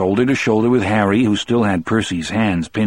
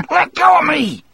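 high-pitched panicked yell; shouted protest; 3 dB louder than usual. A narrator's reading. Percy struggling in Harry's grasp, high shout. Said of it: "Percy shouted".

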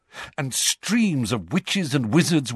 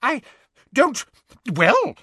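blustering; 13 semitones higher than usual. Acted. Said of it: "blustered Fudge".

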